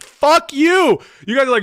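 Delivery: high, loud, furious cry; angry outburst. Real, not acted. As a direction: blow up at them in real outrage. Ranting at pro-Pentagon journalists.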